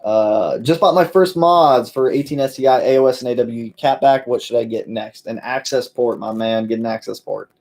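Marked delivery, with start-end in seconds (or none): none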